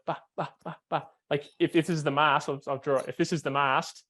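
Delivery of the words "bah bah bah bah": soft, light, quick bahs; light footed bahs. Shown as a demonstration. Coach shows the student light-footed pumping, soft quick bahs. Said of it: "really light footed".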